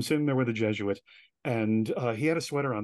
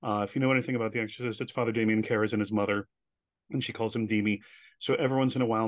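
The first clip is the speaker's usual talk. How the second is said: ordinary talk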